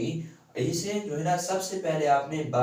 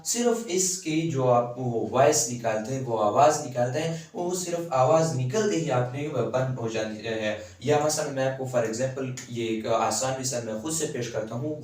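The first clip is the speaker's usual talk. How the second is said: ordinary talk, nothing marked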